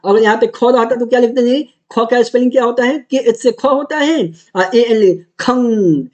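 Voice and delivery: usual speaking voice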